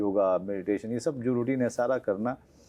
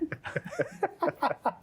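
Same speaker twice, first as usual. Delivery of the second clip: high-pitched burst of laughter; hearty laugh; 4 dB quieter than usual. Real, not acted. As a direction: laugh hard at your own joke. Joking about six-pack actors. (ज़ोर की ऊँची हँसी)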